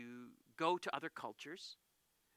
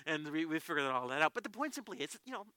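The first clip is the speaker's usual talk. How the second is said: ordinary talk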